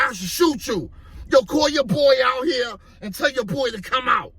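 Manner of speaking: high-pitched menacing order; acted gunman's threat. Gunman ordering teenager to call his partner out, acted angry threat. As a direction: order him about at gunpoint.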